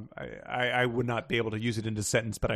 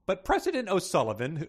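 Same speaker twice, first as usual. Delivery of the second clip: usual speaking voice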